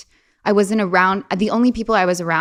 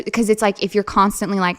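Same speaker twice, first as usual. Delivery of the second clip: ordinary talk, nothing marked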